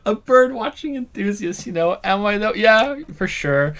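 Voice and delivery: high and loud, gushing; excited. Acted. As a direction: gush like an eager birder. Excited bird enthusiast voice.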